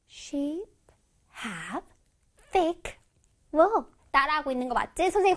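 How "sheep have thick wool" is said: word by word, long pauses; slow follow-along reading. Shown as a demonstration. Teacher repeating along with children, slowly, word by word.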